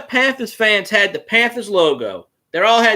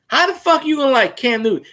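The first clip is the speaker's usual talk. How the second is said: incredulous; 8 semitones higher than usual. Retold or imitated. Retold disbelief at fan.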